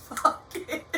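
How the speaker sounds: airy laugh into a breathy Focus; laughing repeat. Real, not acted. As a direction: laugh it off, half whispering. Laughs after scolding herself.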